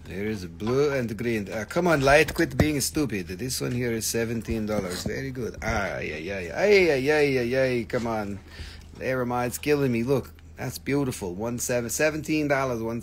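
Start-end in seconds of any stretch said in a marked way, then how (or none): none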